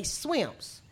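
"swimps" with an added S; Gullah SWIMPS. Shown as a demonstration. Teacher showing the other Gullah form, "swimps" with final S. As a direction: say "swimps", w for R, s ending. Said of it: "put a S on it".

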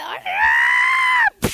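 very high shrieking goat cry; goat roar. Acted. Kicked goat shrieking as it bolts, very high. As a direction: let out a wild goat shriek. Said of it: "the goat said".

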